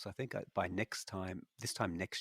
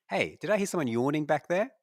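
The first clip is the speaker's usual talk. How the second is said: loud, higher, sudden interruption; mock accusing call-out; 9 semitones higher, 10 dB louder than usual. Host catching a yawner mid-pitch, mock indignant. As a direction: call out the culprit in mock offence. Calls out a colleague for yawning.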